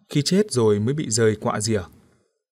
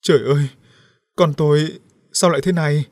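louder, anguished mother's outcry; acted wail, raised but controlled; 4 semitones higher, 5 dB louder than usual. A narrator's reading. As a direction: cry out in grief for a child. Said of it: "bà Nghiêm gào khóc".

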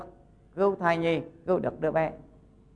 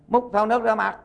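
higher-pitched storyteller's command; mildly raised voice giving an instruction; acted order; 7 semitones higher, 4 dB louder than usual. Woken healer orders the husband.

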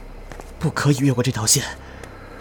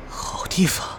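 wary whisper; 8 semitones higher, 3 dB quieter, slower than usual. Acted. Girl hears creepy men's hint.

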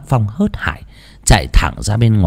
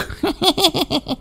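high eerie cackle; high ghostly cackle; 8 dB quieter than usual. Acted. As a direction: shriek a spirit's laugh. Possessed grandma laughs over fish. (tiếng cười ma quái lanh lảnh)

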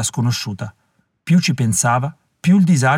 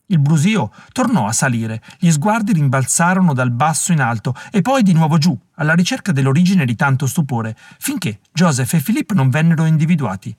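usual speaking voice

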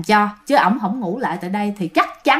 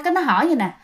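usual speaking voice

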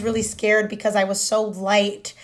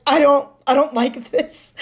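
nervous laugh; 4 semitones higher than usual. Retold or imitated. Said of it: "you're like".

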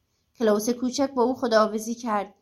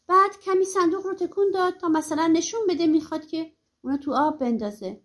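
ordinary delivery, nothing marked

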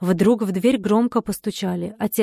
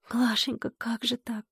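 soft, hushed lament; quiet, tender dismay; grieving; 3 semitones higher, 8 dB quieter than usual. A narrator's reading. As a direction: murmur it in stunned sorrow. Repeated lament while hugging friend.

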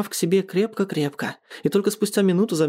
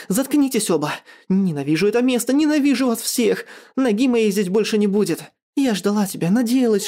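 high-pitched, hurt anger; furious outburst; 6 semitones higher than usual. A narrator's reading. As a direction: rail at everyone before storming off. Furious woman storms out.

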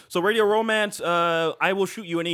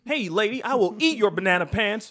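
higher, louder silly threat; playful mock threat; 7 semitones higher, 4 dB louder than usual. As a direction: ham it up.

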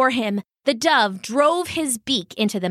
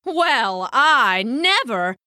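slow, drawn-out affronted huff; indignant dove; 3 semitones higher, slower than usual. A narrator's reading. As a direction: act grandly offended, stretching the words. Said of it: "flapped his wings indignantly".

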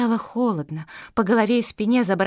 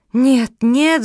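high, loud cry of denial; screamed protest; 4 semitones higher, 8 dB louder than usual. A narrator's reading. Fenya refuses to believe the voice, crying out. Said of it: "закричала Феня".

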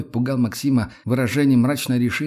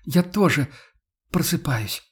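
higher, mildly faltering woman's voice; voice trembling; 5 semitones higher than usual. A narrator's reading. Yana admits she wakes up too, trembling. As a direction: say it close to tears. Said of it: "дрогнувшим голосом повторила она".

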